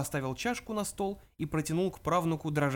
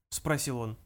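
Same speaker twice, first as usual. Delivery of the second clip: ordinary delivery, nothing marked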